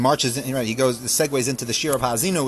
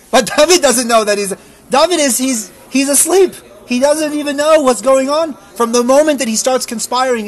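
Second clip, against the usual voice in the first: preaching at full force; 9 semitones higher, 8 dB louder than usual. Acted. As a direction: build the sermon up to a fervent peak. Impassioned sermon peak.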